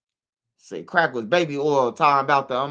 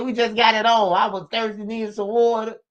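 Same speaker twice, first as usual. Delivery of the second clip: higher mock woman, lightly done; a hint of the mother telling her story; mother explaining, mildly higher imitation; woman's voice; 5 semitones higher than usual. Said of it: "she said".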